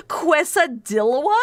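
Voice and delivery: slow "Quesadilla" as kwes-a-DILL-a, questioning; imitated Californian girl. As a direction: stretch "Quesadilla" with Californian mispronunciation. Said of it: "I heard a Californian girl saying".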